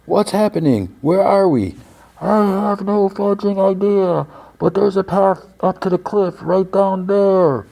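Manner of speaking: high, sustained shout imitation at modest volume; mock distant calling. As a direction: play both sides calling across a distance. Acted shouting between ice and cliff.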